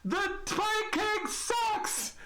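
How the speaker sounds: very high, long drawn-out taunting cry; heckler's yell. Acted. Someone heckling from below deck, high drawn-out yell. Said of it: "hear someone from below deck just go".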